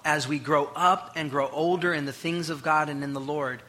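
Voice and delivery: ordinary talk, nothing marked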